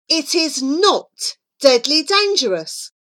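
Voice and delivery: high, emphatic contradiction; exasperated insistence; insistent denial. A narrator's reading. Flora flatly contradicts Charlie.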